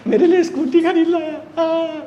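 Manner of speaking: high, laughing girlfriend imitation; mock swooning girlfriend. Mimics a delighted girlfriend. (खुशी से झूमती प्रेमिका की नकल, ऊँची आवाज़)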